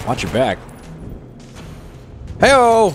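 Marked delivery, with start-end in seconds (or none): 2.4-2.9 loud high cheer; excited whoop. Real, not acted. Player cheering at the screen, loud whoop.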